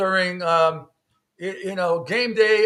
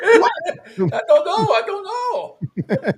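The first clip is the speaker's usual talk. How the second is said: high, slow, laughing put-on voice; co-host joining the skit, laughing and high; laughing mock voice; 13 semitones higher, 7 dB louder, much slower than usual. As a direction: giggle through a silly impression. Mimics flustered waiter.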